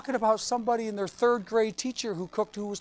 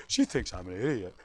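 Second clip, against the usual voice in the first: sulky husband; 11 semitones lower, 6 dB quieter than usual. Retold or imitated. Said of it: "he's like".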